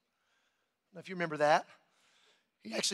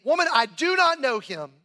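raised, louder acted protest; emphatic denial; 4 semitones higher, 6 dB louder than usual. Peter denying Jesus to a servant girl, emphatic. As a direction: deny it forcefully, pitch up.

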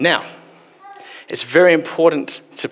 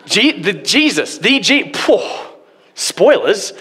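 flustered shock; 6 semitones higher, slower than usual. Retold or imitated. Same character reels from spoiler.

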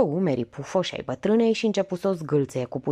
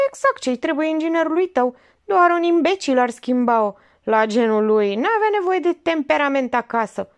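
higher, louder dry teasing; sardonic old aunt; 9 semitones higher, 5 dB louder than usual. Acted. Old aunt needling her niece, dryly teasing.

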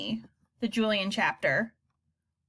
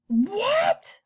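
high, louder shocked cry; yelled; 6 dB louder than usual. A narrator's reading. Mother yelling at the suspension news, shocked. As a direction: shriek it in disbelief. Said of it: "Mom yelled".

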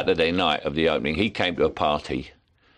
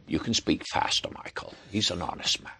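put-on soft, breathy old American director; half-whispered wry director voice; John Huston impression; 7 dB quieter than usual. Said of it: "give me a little bit more of John Huston".

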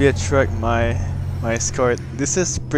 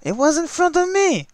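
much higher, pleading it was right there; indignant protest; 9 semitones higher than usual. Real, not acted. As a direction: protest the unfair fail in disbelief. Protesting the game's verdict.

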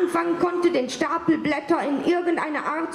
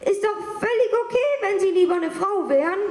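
calling after him; 5 semitones higher, faster than usual. Retold or imitated. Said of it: "Sie rief ihm noch nach".

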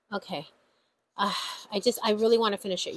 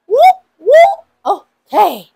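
much higher, loud cheering cry; celebratory whoop; 10 semitones higher, 15 dB louder, slower than usual. Real, not acted. Vlogger whooping loudly about starting new challenges. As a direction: let out a happy whoop.

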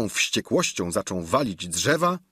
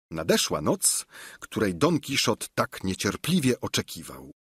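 plain reading voice

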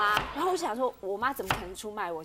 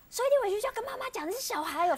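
high, fast mock scolding; angrier husband; 13 semitones higher, faster than usual. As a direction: imitate an exasperated husband's rapid rebuke. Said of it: "然后他就更生气".

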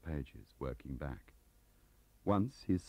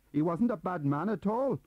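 higher, louder Welsh defence; Welsh accent; 8 dB louder than usual. Acted. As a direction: protective Welsh clerk. Gaunt defends Leo.

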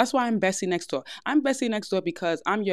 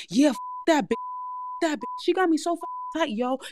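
rough tough talk; 9 semitones higher than usual. Shown as a demonstration. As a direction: snap it like a tough homegirl. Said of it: "than opposed to saying".

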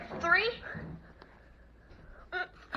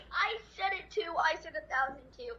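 usual speaking voice

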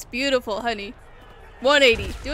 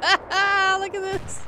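laughing; 7 semitones higher, much slower than usual. Real, not acted. Laughing at stupid AI.

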